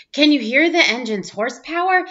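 plain reading voice

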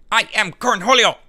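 hammed-up crazed cartoon teen; Cornholio voice. Meme quote, Beavis voice.

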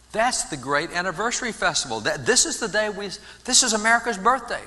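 building excitement. Retold or imitated. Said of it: "look back at that day and say".

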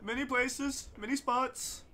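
mock wildlife commentary; nature documentary. Joins mock documentary, higher.

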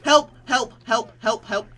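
high, loud desperate cries; real panic, shouting help; yelling for help. Real, not acted. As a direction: call for help while cornered. Trapped by zombies.

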